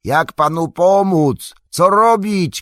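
high, loud anxious call; drawn-out high yell; shouting. A narrator's reading. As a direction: shout down urgently for instructions. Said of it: "krzyknął Sam".

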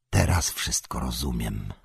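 low, soft, grave realization; Gandalf's murmur. A narrator's reading. Wizard finally understands the danger, low murmur. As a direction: mutter it low, to yourself. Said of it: "mruknął Gandalf".

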